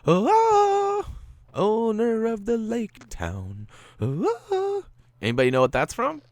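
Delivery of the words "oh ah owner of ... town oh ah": very high, loud, slow falsetto shout; hammed-up squealing announcer voice; movie trailer parody. Gamer hams up a TV show line for viewers, squealing falsetto.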